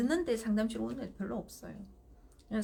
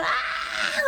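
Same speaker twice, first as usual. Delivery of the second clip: loud, high mock crying scream; imitated child's wail; 6 dB louder than usual. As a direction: wail out like a bawling toddler, acted. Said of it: "하고 또 울고".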